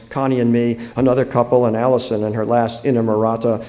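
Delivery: ordinary delivery, nothing marked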